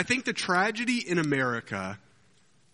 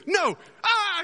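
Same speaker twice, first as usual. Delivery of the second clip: high, loud comic yell; mock panicked scream; 4 dB louder than usual. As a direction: shriek it as the knight thrown to his doom. Knight corrects himself, gets thrown.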